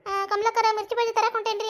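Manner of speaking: teasing imitation of a shy man excusing himself; bashful Mallappa. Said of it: "ಮಲ್ಲಪ್ಪನ ಹಂಗೆ ನಾಚ್ಕೊಂತ". (ನಾಚಿಕೊಳ್ಳುವ ಗಂಡನ ಅಣಕ)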